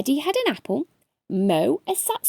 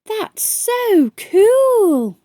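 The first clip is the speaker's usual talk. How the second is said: higher, louder, very slow and amazed; dazzled kid drawing out every word; thrilled child; 11 semitones higher, 8 dB louder, much slower than usual. A narrator's reading. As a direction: stretch it out like a wowed little boy. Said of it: "gulped Daniel".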